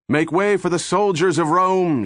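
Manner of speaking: high ringing yell over the crowd. A narrator's reading. The captain yells to the crowd to clear the path, loud yell. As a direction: call it out to the crowd. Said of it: "she yelled".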